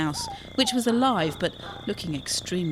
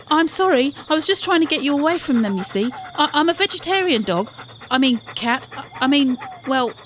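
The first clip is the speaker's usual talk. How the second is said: higher, louder and fast, tripping over the words; flustered apology; 8 semitones higher, 5 dB louder than usual. Acted. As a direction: stumble nervously through the excuse. Quinn flustered, stumbling apology.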